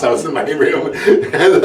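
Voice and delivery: ordinary talk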